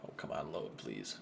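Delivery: soft, muttered plea to the screen; quietly begging it to load; pleading with a machine. Real, not acted. Slow stream will not load.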